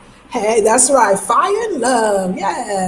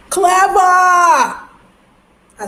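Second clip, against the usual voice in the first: high, loud, drawn-out exclamation; delighted shout; 8 semitones higher, 6 dB louder, much slower than usual. Real, not acted. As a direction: shout it high in triumph at cracking the word. Delighted at working out the word.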